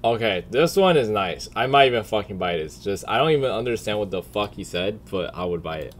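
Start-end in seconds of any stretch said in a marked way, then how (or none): none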